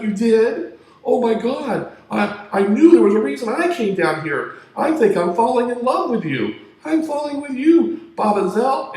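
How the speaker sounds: high, loud, swooning declaration; lovestruck angel. Retold or imitated. Angel swoons loudly and high to the dancer. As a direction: squeal it out, head over heels. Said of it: "He's like".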